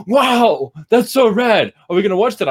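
loud, high-pitched imitation of an excited fan; retold thrilled reaction. Teacher quoting intern after Snake Eyes trailer, thrilled. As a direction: burst out as her, wowed and eager.

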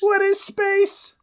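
very high, put-on old-world voice; medieval peasant voice. Man from the past questions a stranger, very high put-on voice. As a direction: squeak it like a frightened peasant from the past. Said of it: "I'm the one that has to do the accent".

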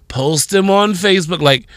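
usual speaking voice

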